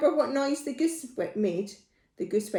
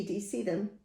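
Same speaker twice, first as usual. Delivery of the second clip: usual speaking voice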